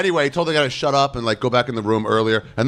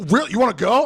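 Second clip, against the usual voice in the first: challenging taunt; 9 semitones higher than usual. Retold or imitated. Cokehead challenging the comic to fight, high taunt. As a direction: taunt rising high on wanna. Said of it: "And he was like".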